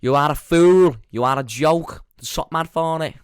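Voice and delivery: loud, high Manchester swagger; Manchester accent. Shown as a demonstration. Podcaster shows his Manchester one, high loud Mancunian. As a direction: proclaim it in broad mock Manchester, loudly. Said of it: "That's my Manchester one".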